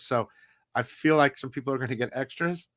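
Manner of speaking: ordinary talk, nothing marked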